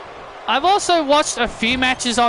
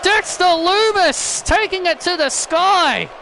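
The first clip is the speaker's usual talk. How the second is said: high, drawn-out shout on the wrestler's name; excited play-by-play; 6 semitones higher than usual. Acted. Commentator amazed as Dexter Lumis takes to the sky, excited play-by-play. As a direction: call the big move with rising excitement.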